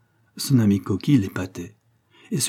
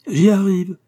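high, loud insistent reply; emphatic retort; 10 semitones higher, 7 dB louder, slower than usual. Acted. Coquille answers his impatient friend, loud and insistent. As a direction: fend off the interruption loudly.

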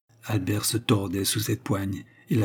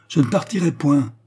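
loud, higher defiant cry; shouting; 6 semitones higher, 6 dB louder than usual. Acted. Albert turns on his wife, shouting defiance. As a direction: yell it at her. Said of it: "cria-t-il".

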